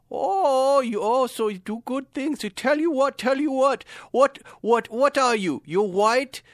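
high, scornful mock Middle Eastern scammer; angry Middle Eastern accent. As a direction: challenge them in a put-on Middle Eastern accent. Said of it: "angry Middle Eastern guys".

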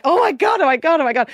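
high, loud fangirl gushing; hammed-up swooning admiration; mock awe. Gushing over perfect bloggers.

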